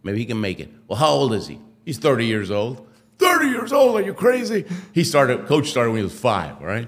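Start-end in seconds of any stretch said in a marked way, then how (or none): 3.2-4.6 high, loud incredulous cry; shocked disbelief. Retold or imitated. Coach hearing the brother is thirty, shouting in disbelief. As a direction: coach can't believe a thirty-year-old wants to start.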